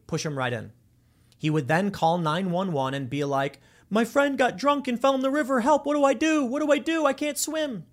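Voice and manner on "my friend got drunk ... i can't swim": high-pitched frantic plea for help; hammed-up distress, much higher voice; mock panicked caller. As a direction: feign helpless panic on an emergency call. Acts fake panicked emergency call.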